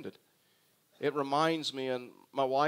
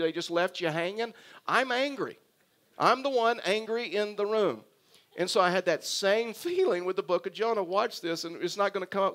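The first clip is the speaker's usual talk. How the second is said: ordinary talk, nothing marked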